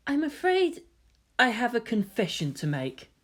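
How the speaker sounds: a hint of a gentle confessing youth; Kaoru's voice.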